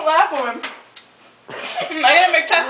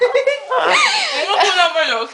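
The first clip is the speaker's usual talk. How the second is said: laughing; 6 semitones higher, slower than usual. Real, not acted. Laughing while talking.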